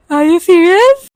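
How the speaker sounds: loud, slow stunned question; shocked. Retold or imitated. Hearing from her sister she talked in her sleep, loud slow shock. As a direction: gasp it out in disbelief. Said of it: "I was like".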